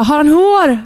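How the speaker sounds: high, loud urgent shout; shouting question. Retold or imitated. Mother in labour shouting to midwives, urgent loud cry. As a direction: yell it out mid-push. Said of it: "jag vet att jag skriker".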